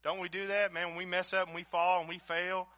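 loud, high, impassioned preacher's cry; fiery preaching. Acted. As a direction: preach it at full force, pleading. Preaching at full force.

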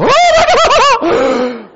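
very high, long, loud scream; mock falling scream. Skydiver pushed out of the plane, long comic scream. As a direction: scream as if plummeting, drawn out. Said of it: "you can hear me go".

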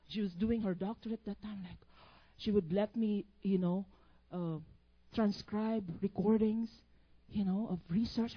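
ordinary talk, nothing marked

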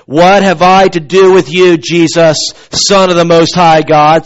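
loud scriptural outcry. Acted. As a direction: cry it out, measured and forceful. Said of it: "with a loud voice".

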